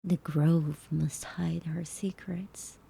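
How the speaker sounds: slow hushed whisper. Pretend. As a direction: whisper it slowly like a secret. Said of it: "I wonder if I could sound like them".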